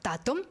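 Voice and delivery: da-dum with the stress on dum; iamb rhythm demo. Shown as a demonstration. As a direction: chant da-dum, light then heavy. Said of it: "at man siger da-dum".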